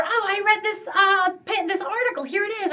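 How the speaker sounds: bright, eager pitch-up pushing a book; overeager advice. Retold or imitated. Coach gushing a book at a complaining friend, overeager. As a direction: gush about the book you want them to read.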